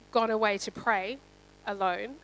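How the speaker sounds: normal talking voice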